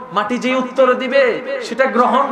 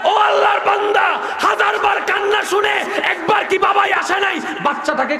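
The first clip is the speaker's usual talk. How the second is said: fiery preaching; 6 semitones higher than usual. Acted. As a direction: preach it with full fire to the crowd. Preaching at full force. (উচ্চস্বরে জোরালো ওয়াজ)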